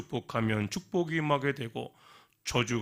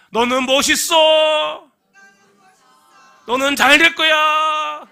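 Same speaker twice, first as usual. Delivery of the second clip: high, slow, much louder calls; shouting blessings; 17 dB louder, much slower than usual. Pretend. Preacher leading the girl's blessing calls, slow, high and loud. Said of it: "해볼까요?".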